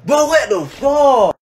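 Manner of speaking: high shocked shouting. Real, not acted. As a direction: yelp in shock. Knocks teeth out dancing.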